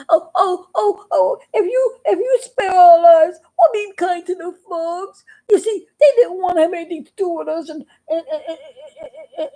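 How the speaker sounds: high, tearful stuttering; stammering plea. Acted. Toads stammering excuses to the bird to spare them. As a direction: stumble over words, pleading and teary.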